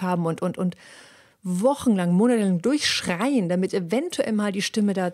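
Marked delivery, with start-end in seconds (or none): none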